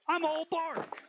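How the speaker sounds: high, soft pun punchline; punchline lilt. Acted. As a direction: toss off the pun high and softly. Tree delivers its pun.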